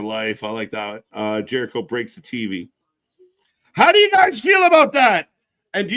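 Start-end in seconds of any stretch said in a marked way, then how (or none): 3.7-5.3 high, loud question to chat; excited question. Real, not acted. Host asking viewers, loud excited question. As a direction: fire it at the chat.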